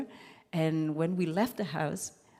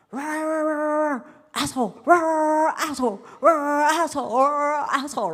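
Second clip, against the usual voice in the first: loud, higher imitated shouting of the sixteen-year-old superior; mock barking yell; 10 semitones higher, 10 dB louder than usual. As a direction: bellow it like a drill sergeant, hammed up. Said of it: "he talked for so long and so loud".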